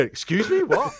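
high, startled, laughing disbelief; shocked woman. Retold or imitated. Costa server hearing the warning, high shocked laugh. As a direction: act out a stunned barista. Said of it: "this woman go".

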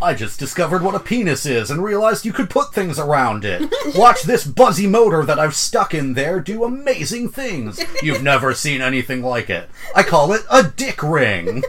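loud, high, laughing mock inventor; clueless inventor voice. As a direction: ham up a clueless, overexcited product guy. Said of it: "think they are revolutionizing the industry".

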